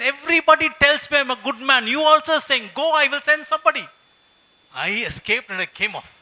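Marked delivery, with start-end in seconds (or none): 0.0-3.9 much higher and louder, agitated complaint; shaken supervisor. Retold or imitated. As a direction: play the rattled boss, voice up and loud.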